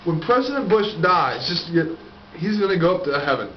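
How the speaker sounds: ordinary delivery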